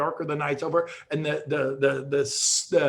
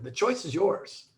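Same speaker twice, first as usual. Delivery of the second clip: normal talking voice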